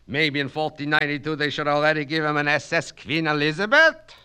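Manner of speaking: fast, loud mocking question in a Yiddish lilt; sarcastic. Plansky mocking Kaplan from the rear wall, sarcastic. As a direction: sneer at the idea, rushing it out.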